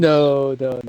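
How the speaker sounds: loud protest. Real, not acted. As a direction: cut in loudly to object. Protesting suggestion of safety cars.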